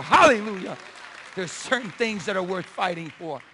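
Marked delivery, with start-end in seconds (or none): 0.0-0.8 shouted hallelujah. Acted. Preacher exclaims to congregation.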